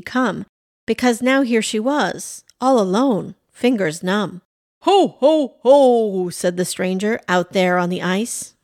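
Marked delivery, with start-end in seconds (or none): 4.8-6.3 big, loud acted Santa laugh; Santa's ho-ho-ho. A narrator's reading. Stranger on the ice greets Pam, Santa's ho-ho-ho. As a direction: boom it out like Santa.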